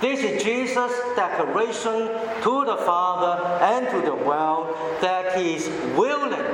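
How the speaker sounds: usual speaking voice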